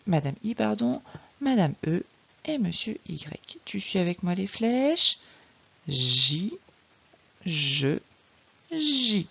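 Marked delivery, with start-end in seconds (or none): 5.9-9.3 "J", "je", "J" with a long buzzing zh; soft "ji, je, ji", each syllable held. Shown as a demonstration. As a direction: sound out "J", "je", "J" one by one, like a water jet. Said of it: "il chante comme le jet d'eau".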